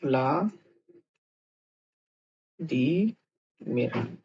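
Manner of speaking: Vladimir drawn out syllable by syllable, very slow; slow demo. Shown as a demonstration. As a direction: stretch Vladimir out slowly so each part is heard. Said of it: "common या famous name है Vladimir".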